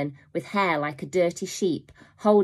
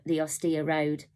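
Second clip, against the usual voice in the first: usual speaking voice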